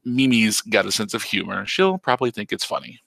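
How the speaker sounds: plain reading voice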